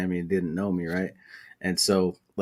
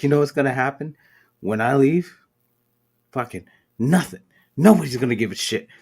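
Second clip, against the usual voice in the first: telling him off; 5 semitones higher, 5 dB louder than usual. Retold or imitated. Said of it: "Like, just went off".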